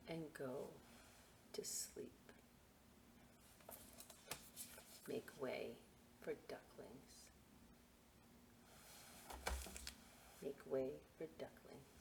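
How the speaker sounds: fading to a whisper at the story's end; hushed storybook ending. Acted. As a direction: let the last words drift into a whisper. Bedtime ending of picture book.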